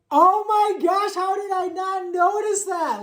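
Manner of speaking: high, loud squeal of delighted surprise; excited amazement. Real, not acted. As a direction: gush in high-pitched, drawn-out amazement. Delighted surprise at undressed book.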